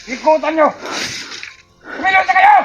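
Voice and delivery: loud villain order; commanding shout. Acted. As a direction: bark the order. Boss ordering men.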